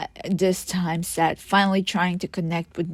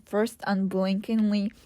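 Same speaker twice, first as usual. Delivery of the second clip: usual speaking voice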